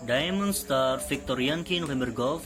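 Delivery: calm radio operator call; marine radio. Shown as a demonstration. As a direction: read it like a VHF radio exchange. Radio call demo dialogue.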